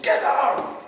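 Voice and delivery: hammed-up angry policeman yelling; mock shout. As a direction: bark it like a fed-up cop. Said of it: "He said".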